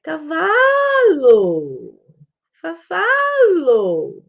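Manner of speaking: put-on high, loud, very drawn-out child's call; sing-song child's exclamation, falling at the end; toddler nearing the word. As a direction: stretch the middle vowel like a toddler practising a word. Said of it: "Ela vai falar".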